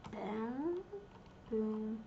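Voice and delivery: ordinary talk, nothing marked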